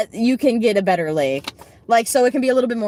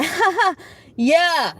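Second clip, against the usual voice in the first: fake polite laugh; 7 semitones higher, slower than usual. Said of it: "you think I'm gonna be like".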